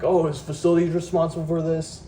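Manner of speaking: high, put-on whiny voice; mocking the site leader. Retelling the meeting, mocking the site leader in a high, whiny voice. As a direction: mimic him as a clueless boss.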